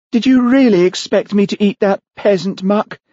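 high-pitched, haughty indignation; scornful outrage. Acted. Olivia turning on Charlie upstairs, scornful outrage. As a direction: fling it at the host with disgust. Offended guest turns on host.

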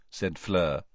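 ordinary delivery, nothing marked

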